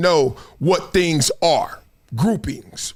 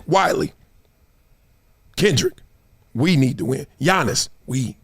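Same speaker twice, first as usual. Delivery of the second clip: pointed coach; 7 semitones lower than usual. Retold or imitated. Coach's real meaning, acted out.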